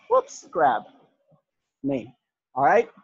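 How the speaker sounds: ordinary delivery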